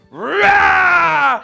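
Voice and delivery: loud, high mock growl; jump-scare the boy with a roar; wolf roar. A narrator's reading. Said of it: "he went".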